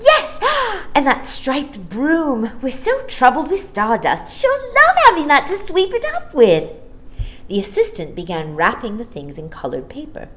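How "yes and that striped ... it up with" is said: put-on high, loud, delighted little girl; excited child. Maya choosing gifts, high delighted little girl. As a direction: gasp at each toy.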